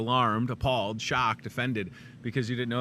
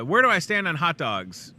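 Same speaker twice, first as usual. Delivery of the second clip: ordinary talk, nothing marked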